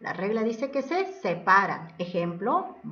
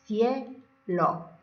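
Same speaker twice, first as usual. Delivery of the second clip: dividido en sílabas. Shown as a demonstration. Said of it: "Ahí van juntas".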